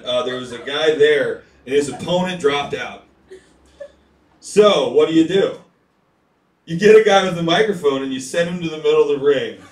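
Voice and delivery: normal talking voice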